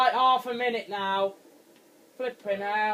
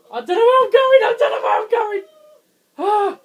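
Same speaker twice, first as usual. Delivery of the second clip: high, loud, near-crying panic; gasping in real fright; terrified panic; 13 semitones higher, 11 dB louder than usual. Real, not acted. Gamer lost in the dark woods, panicking near tears.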